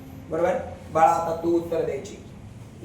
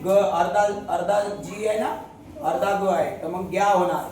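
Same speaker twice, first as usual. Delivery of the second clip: ordinary talk, nothing marked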